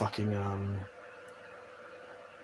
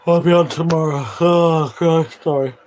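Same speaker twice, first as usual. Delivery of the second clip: real yawn stretching the words, louder; 3 semitones higher, 7 dB louder than usual. Real, not acted. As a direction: talk through a big yawn. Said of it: "I yawned on the fucking coach".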